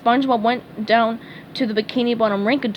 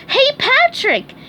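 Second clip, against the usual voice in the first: high, loud, slow call; excited shout to a friend; 6 dB louder, slower than usual. A narrator's reading. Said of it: "he called".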